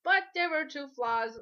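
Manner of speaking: usual speaking voice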